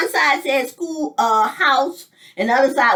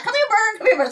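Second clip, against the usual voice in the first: higher, sweet coaxing call; calling the bird; 10 semitones higher, faster than usual. Retold or imitated. Holding hand out to the bird, coaxing call. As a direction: coax a bird onto your hand. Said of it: "You hold your hand out".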